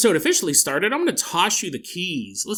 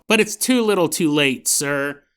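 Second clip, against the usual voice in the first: normal talking voice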